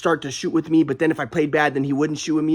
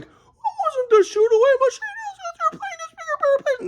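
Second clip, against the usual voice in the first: much higher mocking grandpa voice. Mocking grandpa who blames a bigger playing style, high voice. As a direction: parody grandpa's wrong theory. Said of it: "my grandpa was like".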